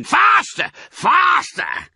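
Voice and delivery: very high, loud yell; frenzied shouting. A narrator's reading. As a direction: shout it like a madman urging on the drivers. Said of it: "and shouting".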